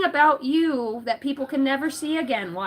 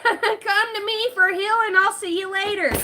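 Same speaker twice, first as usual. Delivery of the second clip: mock healer; 8 semitones higher than usual.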